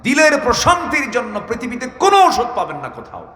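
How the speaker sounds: high-pitched proclamation; impassioned preaching. Real, not acted. Preacher tells crowd the heart has no other medicine, impassioned preaching. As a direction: declaim it high, dropping only at the very end.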